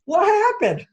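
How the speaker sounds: very high squeak of confusion; completely thrown, squeak it out; baffled. Retold or imitated. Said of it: "It was like".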